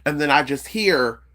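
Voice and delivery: normal talking voice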